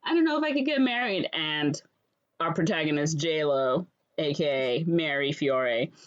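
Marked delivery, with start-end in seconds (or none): 0.0-1.3 high, fast fretting bride; hammed-up nervous bride; mock panic. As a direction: gush out the worry in a hurry. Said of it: "she's, like, panicking".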